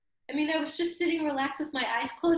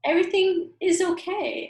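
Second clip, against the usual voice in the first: ordinary talk, nothing marked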